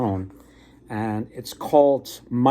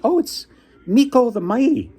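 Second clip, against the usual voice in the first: high, a bit louder grand announcement; proud high fox; 12 semitones higher, 5 dB louder than usual. Retold or imitated. Fox boasting of his master to the king, high proud voice. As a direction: announce the name proudly, pitched up.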